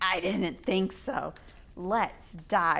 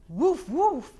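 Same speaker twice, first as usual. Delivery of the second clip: puppy barks; 6 semitones higher, 6 dB louder than usual. Acted. Puppy at the campfire.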